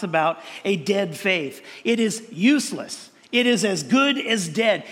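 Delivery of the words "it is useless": ordinary talk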